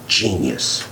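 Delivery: mock praise, dead dry; sarcastic. Real, not acted. Scoffing at voters who trust hosts, sarcastic and dry.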